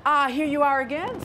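friendly ladybug welcoming the grouchy one back, bright and loud; friendly greeting. Acted. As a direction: greet the returning guest kindly. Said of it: "said the friendly ladybug".

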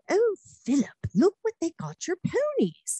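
a high, excited Queen voice showing off the gift; imitating the Queen. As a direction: chirp it out like a delighted elderly queen. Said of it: "she said".

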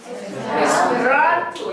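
에스페란토 slow, weight on 란; penultimate stress. Shown as a demonstration. Teacher demonstrates word stress to learners, slow. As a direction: draw out 에스페란토 and lean on 란. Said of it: "끝에서 두 번째 강세가 있을 테니까".

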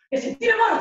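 high, loud angry shout; 1 drunk shout. Retold or imitated. Drunk boyfriend shouting at his girlfriend in the disco, high and loud. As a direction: yell it high and loud, losing it.

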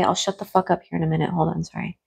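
soft, low hushed apology; quiet hushed voice. Real, not acted. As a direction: keep it quiet, mukbang hush. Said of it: "do a hush tone".

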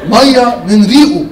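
drawn-out slow punchline. Acted. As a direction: stretch each word slowly for effect. Punchline slowed.

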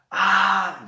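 put-on a high, loud scream of excited kids; children's yell. Shown as a demonstration. Teacher acting out children yelling at the circus, high loud scream. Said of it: "Yell, gritar".

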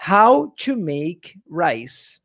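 very slow, careful English; slow for voice search. Shown as a demonstration. Teacher dictating to Google voice search, very slow. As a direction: spell every word out to the microphone. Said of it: "vou falar no microfone aqui".

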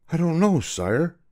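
put-on high, innocent boy's voice; bewildered boy. Boy answers the furious king, bewildered. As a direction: answer helplessly and respectfully.

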